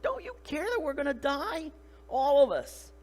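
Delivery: =hammed-up desperate appeal. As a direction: act out a panicked accusation. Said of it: "okay, they're desperate".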